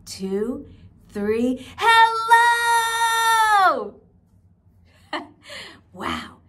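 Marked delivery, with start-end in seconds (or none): 1.8-4.0 very loud, high shouted hello. Shown as a demonstration. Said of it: "say hello as loud as we can". 5.1-5.9 quick high giggle; light laugh. Real, not acted. Laughs after shout.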